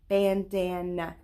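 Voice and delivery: "Bandana" slowly in three separate beats; syllable by syllable. Shown as a demonstration. Teacher clapping with pupils, "Bandana" slow, one beat per syllable. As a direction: stretch and split "Bandana" into three clapped parts. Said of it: "I hear three syllables in that word".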